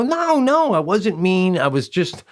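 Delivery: high-pitched defensive denial, easing down; retold protest. Husband denying to his wife he was mean, protesting. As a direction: imitate yourself pleading innocence.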